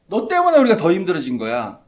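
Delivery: 원망하듯 크게 따지는 목소리; loud accusing voice of the people; angry blame. Retold or imitated. People blame Moses angrily. (화가 나서 모세를 탓하며 크게)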